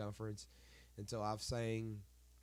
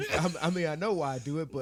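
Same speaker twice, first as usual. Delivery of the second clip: laughing; 4 semitones higher, 8 dB louder than usual. Real, not acted. Laughing at own complaint.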